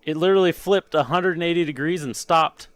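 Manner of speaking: high, loud and astonished; excited amazement. Real, not acted. Streamer telling viewers how the part flipped, excited amazement. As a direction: marvel out loud at the fluke.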